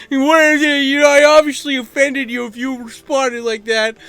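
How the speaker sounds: high, loud put-on voice; mock offended commenter. Offended troll answering his reply, loud high mocking voice.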